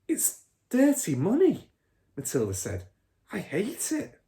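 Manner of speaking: put-on a high, disapproving little girl; a little girl scolding her father; indignant girl. A narrator's reading. Matilda voiced high.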